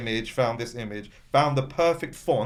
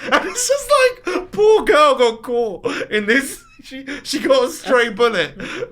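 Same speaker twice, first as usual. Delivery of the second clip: high, laughing while talking; 13 semitones higher, 8 dB louder, slower than usual. Real, not acted. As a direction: giggle through the words. Laughing about the clip.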